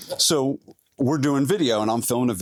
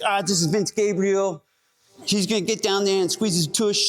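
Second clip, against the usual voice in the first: much higher mock Jersey lady voice; imitating Kathy; 9 semitones higher than usual. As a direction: play the bossy client taking over, high. Kathy mimicked taking over camera.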